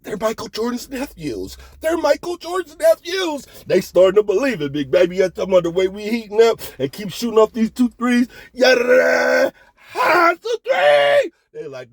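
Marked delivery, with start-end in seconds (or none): 0.0-3.5 high, hushed crowd whispering in awe, rising into a high cry; awed onlookers. Retold or imitated. Casino crowd gossiping about the dice shooters, high and awed. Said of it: "they're like". 8.5-11.3 high shouted dice call. Retold or imitated. Gambler yelling at the craps table, high shout. Shooting dice, calling the roll.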